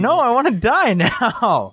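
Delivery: high, drawn-out fake wail; mock despair. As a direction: wail it slowly, hammed-up hurt. Mock hurt after friend's teasing.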